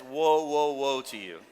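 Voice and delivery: stern, measured whoa; slow him down coolly; a hint of a stern Jennifer; warning whoa. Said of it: "If Jennifer ever says".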